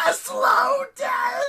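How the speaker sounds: very high, slow, loud shriek; enraged, drawn-out monster screech; screaming goddess. A narrator's reading. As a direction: scream it out, stretching every word. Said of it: "she screamed".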